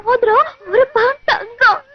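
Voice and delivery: tearful telling, broken by sobs; crying. Acted. Girl telling a young man of her lost heirloom ring, crying. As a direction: sob between the phrases. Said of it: "அழுகாதீங்க".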